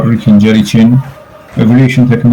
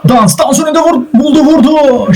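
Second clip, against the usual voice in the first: excited shot call. Real, not acted. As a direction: pitch leaping high in excitement. Calls a shot on goal.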